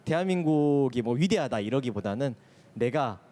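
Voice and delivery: ordinary talk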